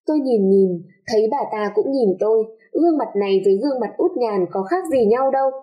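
plain reading voice

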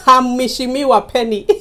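cracking up while talking; laughing. Real, not acted. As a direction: tell your own joke and burst out laughing. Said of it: "you see I'm laughing".

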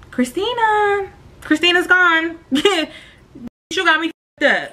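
high, slow, cold brush-off; mock dismissive reply. Answering the boyfriend with a high, slow, cold dismissal. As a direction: act like you have left the building. She answers his call coldly.

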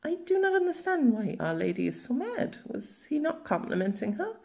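put-on a young servant boy, high-pitched and puzzled; boy's voice. A narrator's reading. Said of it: "the boy asked".

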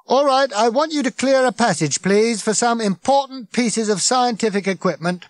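loud, high-pitched public orders; megaphone-style bellow; booming. A narrator's reading. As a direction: project officiously over a crowd. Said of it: "boomed Ford".

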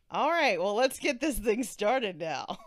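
laughing loudly through the words. Real, not acted. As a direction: laugh while kicking things off. Laughing start of recording.

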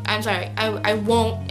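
ordinary talk

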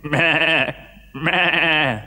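lightly voiced goat; goat bleat. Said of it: "they went".

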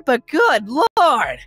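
high, drawn-out exasperation; appalled outburst. Acted. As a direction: stretch it out in scandalized disbelief.

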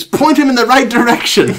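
high, sharp storybook order; urgent British command. Acted. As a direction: call out in a hurry. British doctor gives urgent order.

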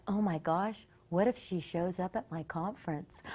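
soft, low worried thought; imitating a hushed inner worry; quietly dreading it. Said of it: "And I felt awful".

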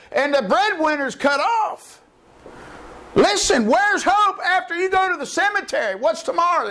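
high-pitched impassioned preaching; preaching at full force. Acted. Preacher warning the congregation there is no hope, impassioned. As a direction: pour it out in a rising sermon cry.

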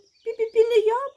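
very high, loud tiny mouse; shrieky little mouse voice; squeaking mouse. A narrator's reading. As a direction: squeak back a refusal. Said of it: "пищить верть".

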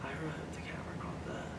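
hushed breathy whisper, barely any voice. Real, not acted. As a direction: whisper so the spirit won't hear. Ghost hunt, fearing being overheard.

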